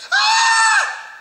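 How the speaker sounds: high, loud squeal of delight; excited greeting scream. Retold or imitated. Mom greeting her best friend, excited scream. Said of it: "they both see each other".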